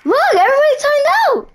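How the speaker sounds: high excited yelling; excited shout. Real, not acted. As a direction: shout it out, hyped. Excited game announcement.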